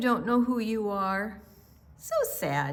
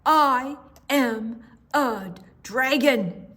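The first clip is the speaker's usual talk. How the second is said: insistent dragon; 4 semitones higher, 4 dB louder, slower than usual. Acted.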